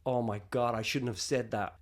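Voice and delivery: low, hushed aside to himself; acted sinking regret, softly; quiet, dropped-pitch self-reproach; regretful thought. Acts out regretful private thought.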